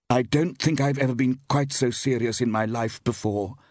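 high, level, grimly serious declaration. A narrator's reading. Actress answering whether she is serious, grim. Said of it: "said Iris grimly".

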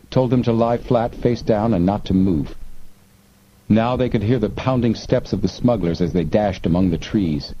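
usual speaking voice

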